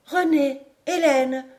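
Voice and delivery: high, clear call. A narrator's reading. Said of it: "élevant sa voix claire".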